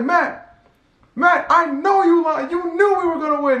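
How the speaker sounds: high, slow mock taunt; teasing Matt. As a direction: rib Matt in a high silly voice. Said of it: "I was like".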